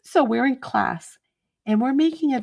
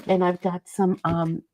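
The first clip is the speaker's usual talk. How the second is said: normal talking voice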